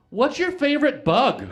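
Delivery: high, loud, drawn-out question; loud random blurt. Retold or imitated. On acid, stopping mid-sex to ask, loud random blurt. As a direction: blurt it out high and slow, out of nowhere.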